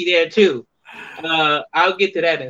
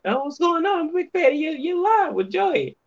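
much higher, friendly mock voice; imitated greeting; 13 semitones higher than usual. Guest mimics someone greeting him backstage, higher voice. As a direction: lightly play the greeter. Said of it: "He said".

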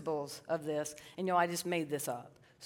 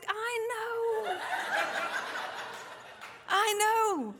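laughing admission; 13 semitones higher, much slower than usual. Acted. Agreeing with wife's judgment.

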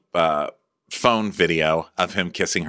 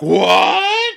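higher, louder shocked what; mock shock; 13 semitones higher, 8 dB louder than usual. Couple reacting to the rent price, mock shocked yelp. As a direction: yelp it in fake disbelief. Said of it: "they're like".

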